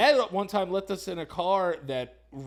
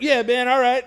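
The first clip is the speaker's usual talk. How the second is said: loud laid-back dude voice; mock homeless buddy; 8 semitones higher, 9 dB louder than usual. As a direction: play a chill street guy, loud.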